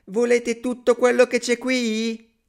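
very high, loud, drawn-out shout; piping character yell; yelling at full throat. A narrator's reading. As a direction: shout to wake the house. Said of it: "gridò subito a squarciagola".

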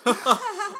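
loud high cackle at his own joke; high burst of laughter. Real, not acted. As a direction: crack up at your own joke.

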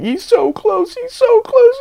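very high, thrilled squeak; excited squeal. Retold or imitated. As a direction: squeal it like a hyped fan. Friend cheering him on.

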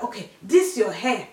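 exaggerated stern mum voice; scolding mum. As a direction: imitate your angry mum laying down the law. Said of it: "And she's like".

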